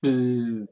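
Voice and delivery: short buzzing device tone; imitated crisp beep. Shown as a demonstration. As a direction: mimic a crisp electronic bzzz. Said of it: "the beep will have a very crisp".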